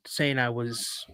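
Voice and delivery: usual speaking voice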